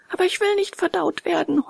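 high, whining wail; howling protest. A narrator's reading. Puppet told he will be digested, howling. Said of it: "heulte Bengele".

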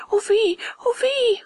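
very high-pitched puppet lament; wailing cry. A narrator's reading. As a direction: wail in dismay. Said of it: "rief Bengele". (hohes, klagendes Rufen)

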